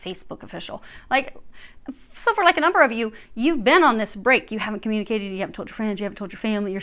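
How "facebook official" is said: breathy mock aside; teasing whisper. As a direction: whisper it softly as a mocking joke. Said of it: "And they keep making fun. They're like".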